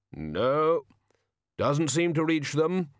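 slow, deliberate solemn figure; slow heavy voice. Acted. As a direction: weigh each word heavily. Hooded figure talking.

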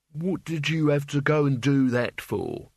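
slow, mildly moaned complaint; moaning. A narrator's reading. Albert, found slumped holding his arm, moans a complaint. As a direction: complain with a drawn-out moan. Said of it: "he moaned".

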